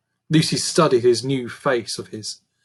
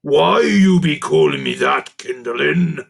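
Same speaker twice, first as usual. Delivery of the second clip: louder, gruff goblin-king voice, wary; suspicious creature king; 7 dB louder than usual. A narrator's reading. Creature king questions the girl, suspicious. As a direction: eye her with suspicion. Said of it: "the king said suspiciously".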